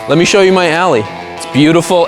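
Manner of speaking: usual speaking voice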